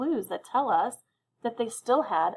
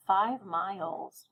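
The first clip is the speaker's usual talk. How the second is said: slow emphasis; much slower than usual. Shown as a demonstration. Teacher repeats key term slowly.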